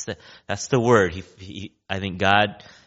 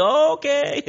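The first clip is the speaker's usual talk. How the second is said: very high-pitched, comic okay; mock-nervous okay, far above normal pitch; wary mock agreement. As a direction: squeak out a hesitant yes, playing it up. Acting people's wary reply to threat.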